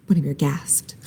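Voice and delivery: whispered narration tag; hushed gasp; breathy whisper. A narrator's reading. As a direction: let the words fall to a whisper.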